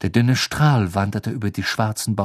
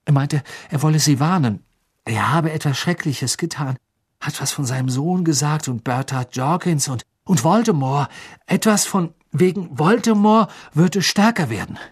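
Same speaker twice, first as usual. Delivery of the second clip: put-on higher, halting urgent boy's report; agitated Harry; 5 semitones higher than usual. Harry reporting Crouch's warning to Dumbledore, increasingly agitated. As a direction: build in alarm as you report.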